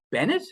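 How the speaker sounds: BENNETT as a question, pitch jumping up; high, puzzled-sounding call of BENNETT. Retold or imitated. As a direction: ask BENNETT with a high rising end. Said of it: "it's a question, like".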